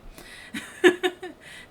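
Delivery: sudden high unvoiced laugh; a bright burst of laughter. Real, not acted. Mocking her own [name].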